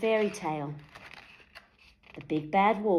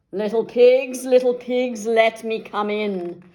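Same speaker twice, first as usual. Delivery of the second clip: loud huffing demand; wolf demanding to be let in; 4 semitones higher, 8 dB louder than usual. A narrator's reading. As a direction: huff it at the door. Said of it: "huffed the big bad wolf".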